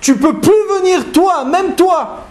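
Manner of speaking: beaucoup plus aigu, ardent et solennel; Rabbi Yoshua véhément. Acted. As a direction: tiens tête au ciel, voix portée très haut. Rabbi Yoshua répond à Hachem. (Rabbi Yoshua répond à la voix céleste, aigu et ardent)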